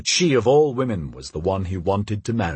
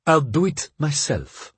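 unmollified refusal; 5 semitones higher than usual. A narrator's reading. Wife refuses to be mollified.

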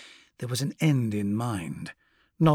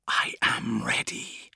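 hissed slow whisper; a demon hissing inside his head; nightmare spirit; 2 semitones lower, slower than usual. Acted. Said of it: "hissed the voice".

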